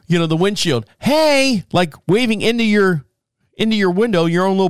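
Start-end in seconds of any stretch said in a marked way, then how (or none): 1.0-1.6 bright high "Hey" imitating a panhandler; cheery greeting. Panhandler at the car window, high cheerful hey. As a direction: call it out brightly, hand waving. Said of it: "They always lean over".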